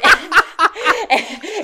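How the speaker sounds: high burst of laughter. Real, not acted. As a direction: keep cracking up. Cracking up at own story.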